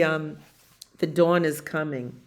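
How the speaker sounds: usual speaking voice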